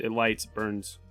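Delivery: ordinary delivery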